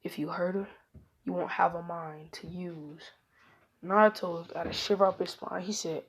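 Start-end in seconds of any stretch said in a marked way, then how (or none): 0.0-3.1 menacing whisper. Acted. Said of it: "He walked in Naruto's ear".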